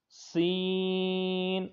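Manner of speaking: سین drawn out slowly with a whistle; long whistling seen. Shown as a demonstration. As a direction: stretch سین, hissing. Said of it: "تو اس میں سیٹی کی آواز آئے گی". (سین کو لمبا سیٹی کے ساتھ)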